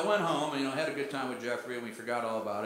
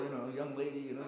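ordinary delivery

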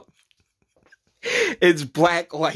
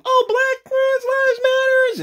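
mocking; 3 dB louder, slower than usual. Mocking quote of activists.

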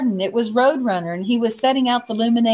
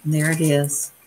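ordinary delivery

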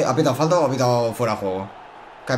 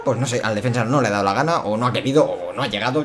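normal talking voice

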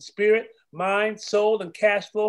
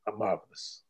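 lower, softer closing murmur; fading soft; 9 semitones lower, 8 dB quieter than usual. Acted. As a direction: let the last word die away. Final refrain fades out.

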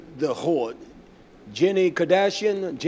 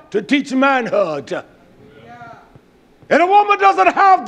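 raised, forceful sermon voice; fiery preaching; 8 semitones higher, 7 dB louder than usual. Real, not acted. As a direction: build to shouted denunciation from the pulpit.

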